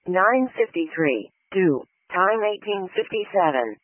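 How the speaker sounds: loud, slow, measured dispatch call; dispatch broadcast. Real, not acted. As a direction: announce the call over the radio, slow and firm.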